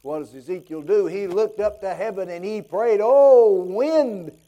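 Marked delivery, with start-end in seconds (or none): 3.0-4.4 a loud cry of Oh, then the word wind falling; calling out in prayer. Acted. Ezekiel cries out to the wind, a loud prayer.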